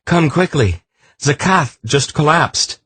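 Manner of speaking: high, loud alarmed shout. A narrator's reading. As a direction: yell the bad news urgently. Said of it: "he shouted".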